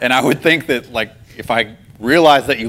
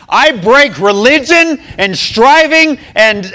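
loud, high, slow divine proclamation; booming voice; 12 semitones higher, 7 dB louder, slower than usual. Retold or imitated. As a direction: boom out each word like a decree from God. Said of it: "I hear it a second time".

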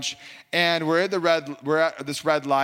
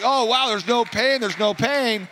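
higher and louder with a wondering rise; amazed delight; 8 semitones higher, 4 dB louder than usual. Retold or imitated. Healed driver moving his shoulder around, amazed there is no pain. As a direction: gasp it out in happy disbelief.

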